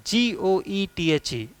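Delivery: slow letter by letter, G O E T H E; spelling out the name. Shown as a demonstration. Teacher spells the name Goethe for students, letter by letter. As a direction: spell each letter apart, slowly. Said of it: "ये जो नाम है इसका pronunciation".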